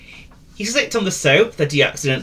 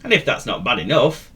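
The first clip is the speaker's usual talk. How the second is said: plain reading voice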